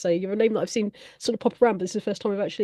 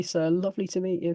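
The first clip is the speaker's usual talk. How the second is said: ordinary delivery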